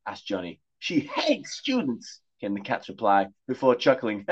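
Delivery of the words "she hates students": high, prim cat voice warning of danger; posh talking cat. Acted. Cat warning the boys of danger, high and prim. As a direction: play the cat as a fussy gentleman.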